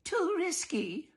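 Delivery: high, slow, fussy mother's verdict; prim mother voice. Acted. Mrs Dollard's voiced line.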